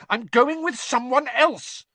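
high, loud, furious insistence; very angry. A narrator's reading. Hermione refuses Ron one last time, very angrily. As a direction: hammer it home in a temper. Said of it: "Hermione said very angrily".

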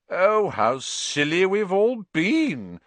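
loud, high, slow sarcasm; sneering. A narrator's reading. Malfoy mocking Hagrid before the class, sneering. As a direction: draw it out in mock realisation. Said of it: "Malfoy sneered".

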